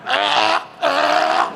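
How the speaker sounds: high, breathless imitated gasps; mock gagging gasps. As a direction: gasp like coming up for air.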